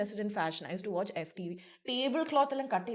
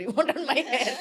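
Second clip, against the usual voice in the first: laughing; 7 semitones higher, 6 dB louder than usual. Retold or imitated.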